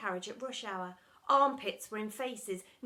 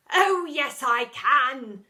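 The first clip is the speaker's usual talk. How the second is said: high, loud thunderous reply; deputy head thundering at the school; 3 semitones higher, 14 dB louder, much slower than usual. A narrator's reading. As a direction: thunder it back. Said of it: "thundered the deputy head".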